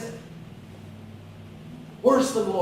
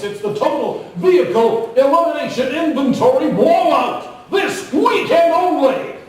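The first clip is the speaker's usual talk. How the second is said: loud, building to a shouted pitch; car salesman ad; 4 semitones higher, 7 dB louder than usual. Retold or imitated. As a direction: hype it like a used car commercial. Imitating used car ads.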